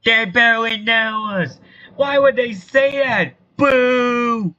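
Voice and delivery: high, loud crowd protest; indignant heckling. Acted. Townsfolk protest at a town meeting, indignant heckling.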